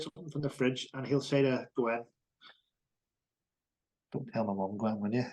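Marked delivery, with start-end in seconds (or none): 4.1-5.3 low, soft retold secret plea; imitating conspiratorial son. Son sneaking from the fridge to ask Gwen, imitated conspiratorially. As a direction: keep it down, as the son asking a secret favour.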